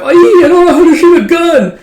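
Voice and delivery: high, loud squeaky panicked voice; mock panic. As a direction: blurt it out in hammed-up panic.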